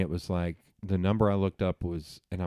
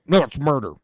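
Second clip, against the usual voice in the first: higher, louder mock insistence; stubborn refusal; 6 semitones higher, 7 dB louder than usual. Mimicking someone against abortion, stubborn and loud. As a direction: shut the argument down, arms crossed. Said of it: "When you cross your arms, you go".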